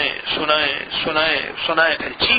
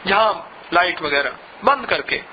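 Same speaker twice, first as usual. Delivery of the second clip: ordinary talk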